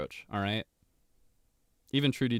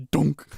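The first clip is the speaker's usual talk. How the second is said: donk sound effect; 4 semitones higher, 7 dB louder than usual. Acted.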